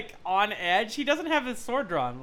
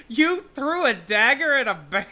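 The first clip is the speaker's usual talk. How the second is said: incredulous; 3 semitones higher, 7 dB louder than usual. Acted. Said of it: "Jalen looks at".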